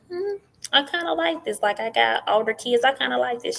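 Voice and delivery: high, pleased musing about the truck. Retold or imitated. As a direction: act out your delighted younger self trying it on. Mother acting own pleased reaction.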